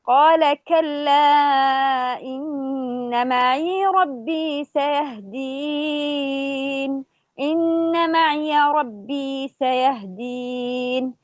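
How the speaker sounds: slow melodic tajweed chant; Quran recitation. Shown as a demonstration. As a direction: stretch the vowels, recite reverently. (تلاوة مرتلة بطيئة)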